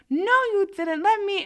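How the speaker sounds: high, loud indignant protest; indignant denial. Retold or imitated. Caller flatly denying the agent's claim, indignant. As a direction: insist he's wrong, outraged.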